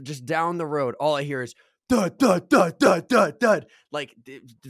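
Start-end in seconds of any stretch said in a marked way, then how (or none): 1.9-3.6 loud, higher, punchy bass-beat imitation; imitated subwoofer thumps. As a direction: pound out each thud like car subwoofers. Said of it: "subwoofers just going fucking crazy in a car".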